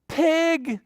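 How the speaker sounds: very high-pitched happy announcement; spring the surprise on little kids; excited reveal. Pretend. Storyteller revealing the animal to children, excited reveal.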